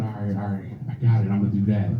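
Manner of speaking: low, soft, fast agreeing mumble; quietly, hurriedly agree to the plan; a hushed kid rushing his yes. Retold or imitated. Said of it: "So I said".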